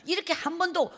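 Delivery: normal talking voice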